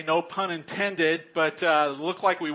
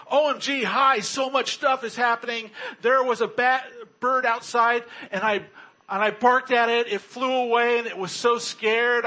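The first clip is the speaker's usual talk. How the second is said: breathless, higher gushing dog voice; a puppy spilling news, begging for praise; excited dog; 6 semitones higher, 4 dB louder than usual. Pretend. Dog texting back.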